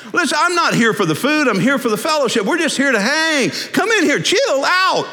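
high-pitched, animated coaxing; easygoing Jesus urging. Acted. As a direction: wave a fussing host in to join you. Voices Jesus urging Martha to relax.

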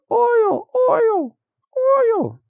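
tiny, very high falsetto squeak; squeaking Tin Man. Acted. Metal Man begging for oil, very high mock squeak. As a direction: squeeze the word out in a rusty-hinge squeak.

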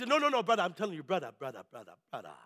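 Jamaican seller's protest, sinking to a low murmur; imitated Jamaican accent, coaxing and dwindling; rusty Jamaican accent. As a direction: insist in a put-on Jamaican accent, then fade right down. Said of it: "a little rusty on my Jamaican ask, uh, uh, accent".

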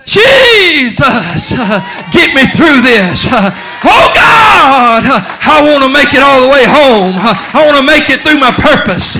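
high, loud crying-out to God; a believer shouting under fire; desperate shouted prayer. Acted. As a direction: cry out to Jesus with all you have. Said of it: "But you'll just".

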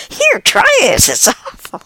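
high, loud urging that drops away; urgent offer. Acted. Person passing on bad food, urgent and high. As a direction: thrust it at them, loud and high.

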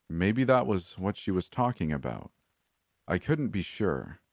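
ordinary delivery, nothing marked

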